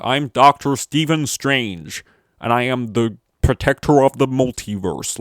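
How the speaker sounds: loud, mock-grand American accent; Benedict Cumberbatch as Doctor Strange. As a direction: declaim it in an overdone American accent. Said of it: "the accent Benedict Cumberbatch does in Doctor Strange".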